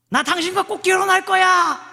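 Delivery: high, loud declaration of marriage; emphatic vow. Acted. Suitor vowing to marry the beloved, high emphatic shout.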